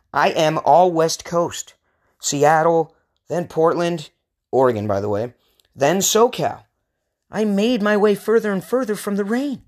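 normal talking voice

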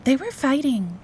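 put-on high-pitched small child voice; tattling little girl. A narrator's reading. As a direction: tell on them to Daddy, pitched high. Little girl tattles to her father.

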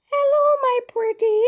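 very high, loud falsetto granny disguise; wolf crying out as Gran. Acted. Wolf in Gran's clothes greeting Red, high crying falsetto. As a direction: call out sweetly in a shrieky fake granny voice. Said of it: "he cried".

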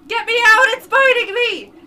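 high, loud screams of pain; agonized screaming. Acted. Mike screaming as the crate creature bites, loud and high. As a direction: shriek as if the monster has your arm. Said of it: "Mike's screams are cut short".

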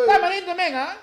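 high-pitched put-on Chinese accent; mock chifa accent. Chifa worker answering a customer, high mock Chinese accent. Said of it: "en un idioma chifero".

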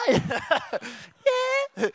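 a laughing shouted yeah. Retold or imitated. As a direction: call back cheerfully through a laugh. Kid answering neighbour, laughing.

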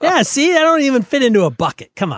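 loud, high, fast mock indignation; playful high protest. Host defends his weird accent to co-host, mock indignant.

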